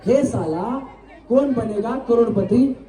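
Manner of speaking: slow, clear model line for repeating; slow demo line. Shown as a demonstration. Host demonstrates the line for contestants to repeat slowly. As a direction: spell it out slowly so they can copy. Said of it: "मी बोलतो, माझ्या पाठीमागे बोला".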